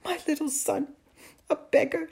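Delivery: high, tearful lament; weeping. A narrator's reading. Mother pictures her son begging, weeping. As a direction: grieve over each phrase. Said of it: "Amal's mother wept".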